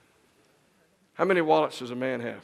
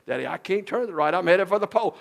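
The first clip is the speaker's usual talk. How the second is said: higher, louder mock panic; a kid crying out to daddy; panicky girl; 6 semitones higher, 6 dB louder than usual. Daughter panics on bike.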